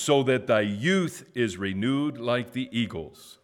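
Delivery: high, loud proclaiming of scripture; preaching. Acted. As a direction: call out each verse for the church to echo. Leads responsive scripture reading.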